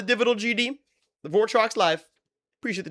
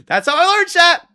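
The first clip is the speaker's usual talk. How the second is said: punchline; 13 semitones higher, 10 dB louder than usual. Real, not acted. Punchline to chat.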